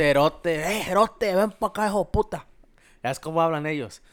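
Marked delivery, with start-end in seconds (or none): 0.0-2.5 louder, higher mock call of "cerote" between buddies; imitating Salvadoran slang. Speaker imitates how Central American friends insult each other, rowdy call. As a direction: call out to a buddy, rough and joking. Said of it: "Yo les digo cerote".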